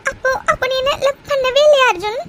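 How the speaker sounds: high, distressed questioning; hurt, tearful panic. Acted. Girlfriend doubts he loves her, hurt and panicked. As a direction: accuse him through tears, pitched high.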